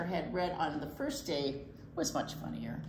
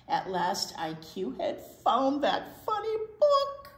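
triumphant; 6 semitones higher, 4 dB louder than usual. A narrator's reading. Triumphant find.

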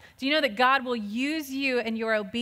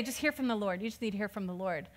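softer, quicker, parroting a churchy phrase; mock churchy cliché; 4 semitones lower, 9 dB quieter, faster than usual. Mocking what church people say, quick and soft. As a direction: toss it off like a tired church saying. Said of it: "a churchy thing to say".

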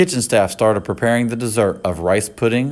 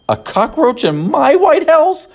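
high, slow, horrified cry; shocked cry; 4 dB louder than usual. A narrator's reading. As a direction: wail it in disbelief. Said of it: "cried the First Lady".

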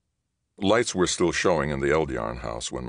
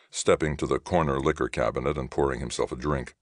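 ordinary delivery

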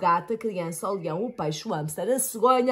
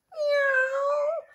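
high meow; high miau; cat meow. A narrator's reading. Cat meows.

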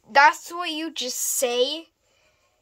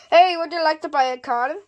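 calling out; 2 semitones higher, 7 dB louder, faster than usual. Acted. Toy play seller calls out.

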